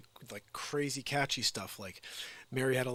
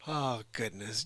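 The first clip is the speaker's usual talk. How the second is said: ordinary talk, nothing marked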